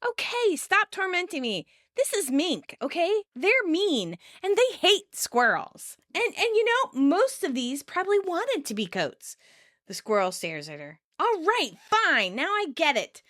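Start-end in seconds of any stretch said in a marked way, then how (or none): 0.1-9.4 high-pitched indignant self-defence; exasperated scolding. Retold or imitated. Phoebe scolding a tormenting squirrel. 11.2-13.2 exasperated surrender. Retold or imitated. Phoebe gives in to squirrel.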